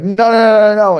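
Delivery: high, loud string of no's; urgent interruption. Retold or imitated. Captain stopping his victim going to the desk, urgent interruption. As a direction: cut in loudly, imitating the panicked prankster. Retold captain cutting off the panic.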